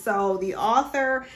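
normal talking voice

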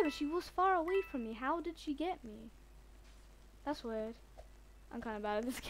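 ordinary delivery

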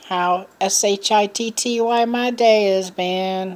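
S H I T T Y spelled slowly letter by letter. Real, not acted. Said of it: "spelling out the swear".

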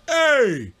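high sharp mock yell. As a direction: call out hey. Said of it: "Flip open his mouth like".

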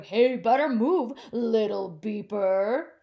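loud street-sweeper holler, slowing on little beeper; big sweeper hollering at a little truck. Acted. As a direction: holler across the traffic.

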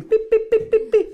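squeaky high pips as the card rises; high, loud cartoon pips; playful high beeping to sell the magic; high pip sound effect.

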